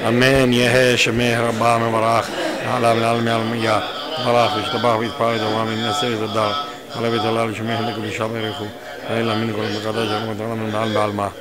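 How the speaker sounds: quiet communal prayer; murmured kaddish. Acted.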